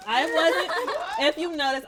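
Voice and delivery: loud laugh through the words; laughing while admitting something. Real, not acted. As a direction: giggle it out loud. Giggling confession to friend.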